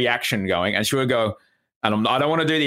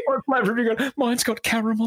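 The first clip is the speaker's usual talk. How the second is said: high, laughing mock sob; mock crying girl; 11 semitones higher than usual. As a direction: pretend to weep through a giggle in a high voice. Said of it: "crying into the Magnum".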